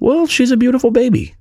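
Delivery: put-on very high cooing baby voice; gushing baby talk. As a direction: coo like over a cradle. Mocking gushing over Amy Adams.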